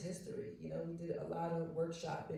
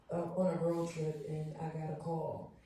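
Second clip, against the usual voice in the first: ordinary talk, nothing marked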